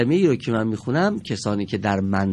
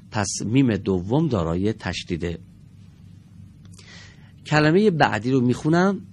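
ordinary delivery

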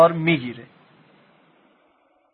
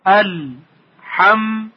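«الحمد» very slowly, paused as ال، حم، د; higher, measured chant of «الحمد» in three separate parts; syllable by syllable; 3 semitones higher, much slower than usual. Shown as a demonstration. Teacher splitting a prayer word for pupils, «الحمد» syllable by syllable. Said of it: "تکرار کنید".

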